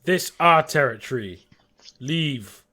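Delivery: firm, loud order in broken speech; terse, commanding big guy; territorial native. Acted. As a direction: warn intruders off your land. Native orders the travelers away.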